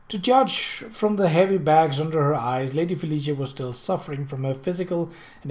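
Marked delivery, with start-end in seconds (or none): none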